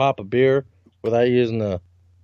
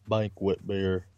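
deep slow ad voice; 7 semitones lower, 10 dB quieter, much slower than usual. Retold or imitated. Imitating old beer commercial.

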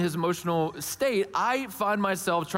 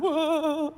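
high, wavering "whoa" wail; imitated ghost wail; 10 semitones higher than usual. Dad imitating his son's meltdown, ghostly wail. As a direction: mimic a child wailing like a ghost. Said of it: "the wailing ghost sound".

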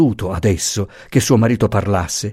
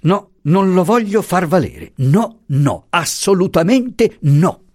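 heated, high-pitched refusal; indignant, raised pitch, emphatic; flaring up; 8 semitones higher than usual. A narrator's reading. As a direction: catch fire and refuse outright. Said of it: "pigliando fuoco".

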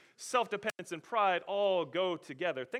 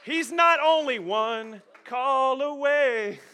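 loud, high, ringing proclamation; full-force preaching; 11 semitones higher, 13 dB louder, slower than usual. Acted. Preacher proclaiming to the congregation, loud and high. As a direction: preach it to the crowd at full volume.

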